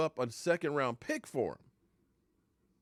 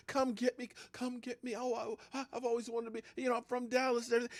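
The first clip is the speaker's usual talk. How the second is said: mocking player's plea; 11 semitones higher, 4 dB quieter, faster than usual. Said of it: "you told us how much you wanted".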